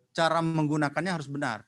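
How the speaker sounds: normal talking voice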